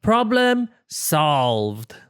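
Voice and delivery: slow, satisfied announcement; proud catchphrase. Acted. Porcupine declaring his fix done, slow and proud. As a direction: savor each word.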